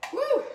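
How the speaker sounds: a sudden high, loud whoop as the onion stings. Real, not acted. Cook stung by onion fumes lets out a high, loud whoop. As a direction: let out a sharp high woo.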